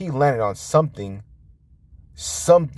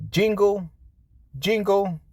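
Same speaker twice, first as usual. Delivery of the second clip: jingle jingle sung up high like a bell; high jingling; 11 semitones higher than usual. A narrator's reading. Narrator mimics bell, high jingling jingle. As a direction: ring it out lightly and high.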